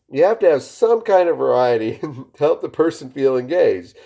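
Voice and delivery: usual speaking voice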